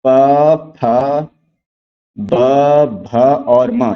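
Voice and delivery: प, फ, ब, भ और म said slowly, each letter alone with long pauses; teacher spelling out प फ ब भ म, with a pause after each letter; letters one by one. Shown as a demonstration. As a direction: sound out प, फ, ब, भ, म one at a time for the class. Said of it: "देखते हैं कि प वर्ग क्या है".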